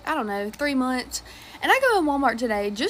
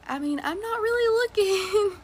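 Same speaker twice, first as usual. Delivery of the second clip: awkward polite dodge; 7 semitones higher than usual. Retold or imitated. Awkward reply to matchmaking.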